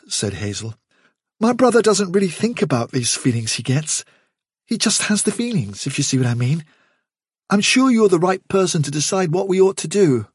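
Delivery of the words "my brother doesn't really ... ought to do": higher-pitched, earnest junior; deferential young rabbit. A narrator's reading. Hazel explaining his brother to the chief, deferential.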